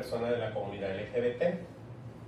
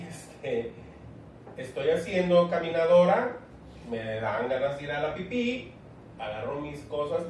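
normal talking voice

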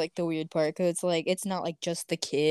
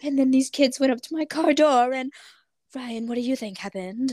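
higher-pitched imitation of a YouTuber telling his story; put-on, higher storyteller voice; Shane Dawson impression; 6 semitones higher than usual. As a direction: mimic a vlogger recounting a creepy encounter. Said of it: "That was my best Shane Dawson impression".

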